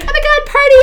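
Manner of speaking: very high, loud party squeal; drunken party excitement. Retold or imitated. Friend imitating herself drinking at a party, squealing with excitement. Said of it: "like, drinking, be like".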